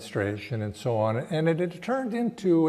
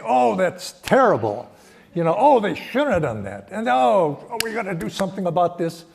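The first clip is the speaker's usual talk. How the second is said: mock outrage, higher and louder; imagined indignant sympathy; 6 semitones higher, 5 dB louder than usual. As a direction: play at being scandalized on a friend's behalf. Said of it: "I wanted him to say".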